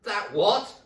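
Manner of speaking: shrieked very high and loud; full panicked shriek; terrified scream. Acted. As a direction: scream for your life. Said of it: "screamed Missus Twit".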